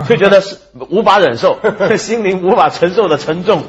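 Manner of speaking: speaking through real laughter; laughing. Real, not acted. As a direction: laugh while you say it. Laughing while telling the story.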